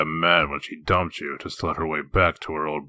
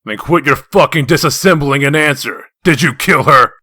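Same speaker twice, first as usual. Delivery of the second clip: loud, raised voice barking the question; furious demand; 6 semitones higher, 10 dB louder than usual. Acted. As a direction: demand an answer, fury rising. Shouting interrogation.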